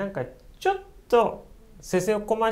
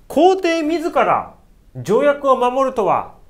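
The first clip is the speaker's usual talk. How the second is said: high, loud and slow accusing; furious rebuke; 10 semitones higher, 7 dB louder, slower than usual. Retold or imitated. Ito scolding the emperor, high, loud and slow. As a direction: drag out the outrage. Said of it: "ものすごい剣幕で".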